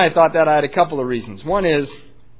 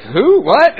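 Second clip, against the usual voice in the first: high, louder puzzled questions; baffled; 9 semitones higher, 9 dB louder than usual. Retold or imitated. Hospital staff asked for a mother not there, mock baffled. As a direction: imitate staff at a total loss.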